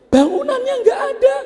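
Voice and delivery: very high, fast, loud outcry; shrill loud protest; protesting wife. Acted. Wife protests to husband, very high and loud.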